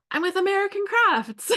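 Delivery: lightly voiced proud sales guy; proud reveal. Guy reveals his company.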